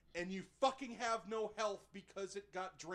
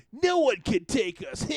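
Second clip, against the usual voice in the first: louder, higher mock-tough brag; gloating thug; 7 semitones higher, 12 dB louder than usual. Thug brags over the hero, louder. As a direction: brag like a cartoon bully.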